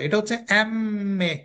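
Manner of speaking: এমএ said slowly as a doubled nasal m; nasal emme. Shown as a demonstration. Teacher showing students how to say এমএ, slow and nasal. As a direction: draw out the এমএ with a humming nasal m. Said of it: "এটাকে chronological sound বলে".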